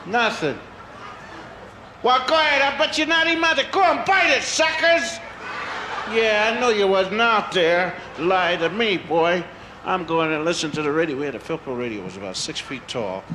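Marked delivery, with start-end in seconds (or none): 2.0-9.4 put-on taunting child. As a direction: dare the snakes to bite.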